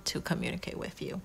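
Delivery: soft whispered aside. Real, not acted. Reader softly whispering a hopeful promise to the viewer. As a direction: breathe it like a secret. Repeated line, voiced then whispered.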